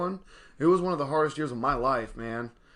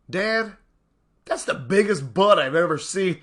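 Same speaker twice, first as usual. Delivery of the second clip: put-on small girl's blurted line, a bit higher and louder; a light child voice, loud and frank; imitating little daughter; 4 semitones higher, 5 dB louder than usual. Dad quoting his four-year-old in the store, a light child voice.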